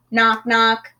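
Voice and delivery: ordinary delivery, nothing marked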